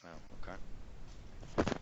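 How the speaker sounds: normal talking voice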